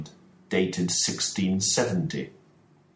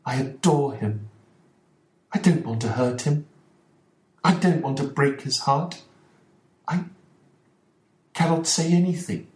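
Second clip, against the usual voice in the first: despairing; 7 semitones higher than usual. Acted. Mrs Ferguson telling Watson nobody can help, despairing. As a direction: give up all hope. Wife pleads her love.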